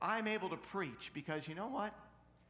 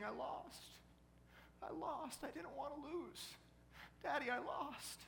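put-on high, small voice of a tearful girl; mock a child's soft, high wail; imitated sobbing; 11 semitones higher, 5 dB quieter than usual. Little girl telling Daddy she lost, imitated sobbing. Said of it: "She's just sobbing".

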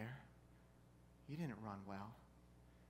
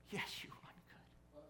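slow, hushed, breathy whisper; reassuring whisper; 11 semitones higher, 7 dB quieter, slower than usual. Retold or imitated. Dad answering his tearful daughter, slow reassuring whisper. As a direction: whisper it tenderly to a child.

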